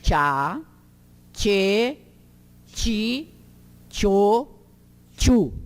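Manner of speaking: "Tchá, tchê, ti, tchô, tchu" repeated slowly apart, "ti" as chee; each of "Tchá, tchê, ti, tchô, tchu" starting with ch as in church; TCH syllable drill. Shown as a demonstration.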